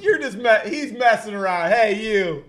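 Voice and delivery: lightly voiced neighbor talking to the dog; mock dog scolding. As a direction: imitate the neighbor going easy on the dog. Neighbors scolding their barking dog.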